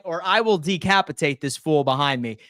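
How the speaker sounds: ordinary delivery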